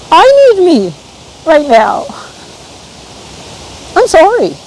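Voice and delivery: high-pitched, overcome with real feeling; heartfelt high plea to oneself; getting emotional. Real, not acted. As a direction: let the emotion push your voice up high. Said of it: "I'm getting emotional".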